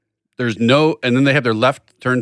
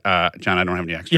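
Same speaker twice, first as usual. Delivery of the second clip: low, soft grudging refusal; stingy mumble; 9 semitones lower, 4 dB quieter than usual. Retold or imitated. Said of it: "your answer would have been like".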